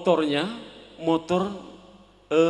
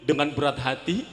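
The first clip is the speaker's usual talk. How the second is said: normal talking voice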